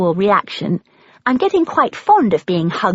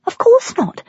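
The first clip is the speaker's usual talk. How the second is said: much higher, shocked denial; horrified; 4 dB louder than usual. A narrator's reading. Granddaughter accused of coming for money, horrified denial. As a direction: recoil from the very idea.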